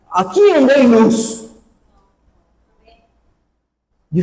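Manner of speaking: fervent preacher calling out to the church; full-force preaching. Acted. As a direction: preach it out to the congregation. Preaching from the pulpit.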